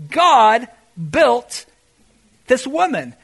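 normal talking voice

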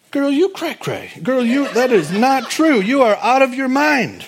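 high, loud, mocking scoff; sassy dismissal. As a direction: brush her off like she's crazy. Praying friends voiced dismissing Rhoda.

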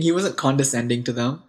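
normal talking voice

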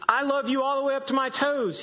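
high, giddy bunny voice; small hare delighted with his idea; little hare voice. Acted. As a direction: squeak it out, proud and upside down. Little hare upside down boasting.

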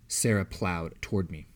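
ordinary delivery, nothing marked

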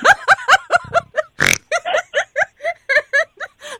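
long, loud, high laughing fit; real laughter. Real, not acted. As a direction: crack up at your own rudeness. Laughing at her own rude refusal.

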